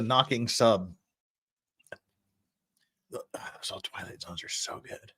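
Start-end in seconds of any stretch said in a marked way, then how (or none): 3.1-5.1 hushed voiceless aside; whisper. Acted. Whispering to chat about old Twilight Zones. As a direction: whisper it like a guilty pleasure.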